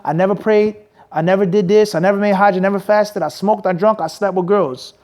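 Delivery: ordinary delivery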